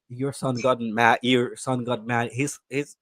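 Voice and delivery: ordinary delivery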